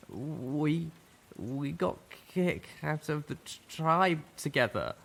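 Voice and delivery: words catching in a tight throat; choked-up young zebra. A narrator's reading. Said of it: "He choked, tears forming".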